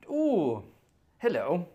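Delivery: high, loud playful greeting; high cooing hello; mock delighted. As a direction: coo it like a flirt.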